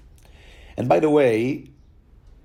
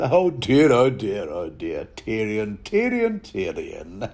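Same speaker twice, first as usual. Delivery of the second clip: patronizing; 2 semitones lower, slower than usual. Retold or imitated. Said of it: "patronize the hell out of".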